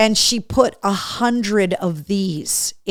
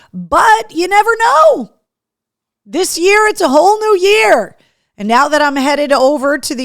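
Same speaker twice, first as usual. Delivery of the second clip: excited; 8 semitones higher, 7 dB louder than usual. Real, not acted. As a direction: sing it out with big hope. Hyping the podcast's reach.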